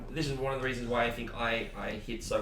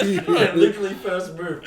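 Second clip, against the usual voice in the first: laughing; 7 semitones higher, 9 dB louder than usual. Real, not acted. Recalling the deal, laughing.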